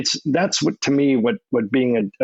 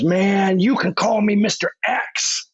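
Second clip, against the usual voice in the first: [name]'s voice; 7 semitones higher than usual. Retold or imitated. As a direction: play the rapper coolly correcting him. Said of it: "And he goes".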